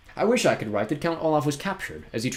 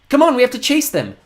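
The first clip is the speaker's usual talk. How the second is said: put-on much higher, urgent girl's shout; 14 semitones higher, 11 dB louder than usual. A narrator's reading. Violet urges her siblings, shouting. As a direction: call out urgently. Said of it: "Violet shouted".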